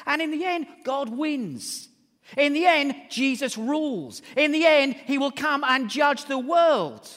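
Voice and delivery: loud, high pulpit proclamation; triumphant preaching. Real, not acted. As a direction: build to a ringing climax, stretching the final words. Preaches to a climax.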